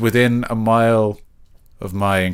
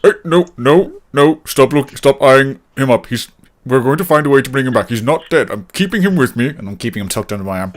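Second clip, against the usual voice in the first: protective scolding; 4 semitones higher, 5 dB louder than usual. Acted. Character scolds greedy companion.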